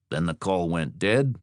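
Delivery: ordinary delivery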